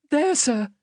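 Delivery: high, timid little voice; timid child. Acted. Child pointing out his mother to the man, timid. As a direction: explain meekly, as a scared child. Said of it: "I timidly explained".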